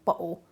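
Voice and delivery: "bottle" broken by a hard catch in place of the t; strong glottal stop. Shown as a demonstration. Linguist showing what Cockneys do, glottal "bottle". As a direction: cut the t of "bottle" off in the throat. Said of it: "doing a really strong glottal stop".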